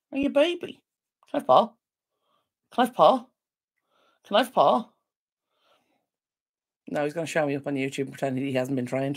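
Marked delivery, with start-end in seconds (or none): 0.1-4.9 high singsong asking the dog for a paw; talking to the dog, higher and coaxing. Real, not acted. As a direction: coax the dog in a sweet high voice.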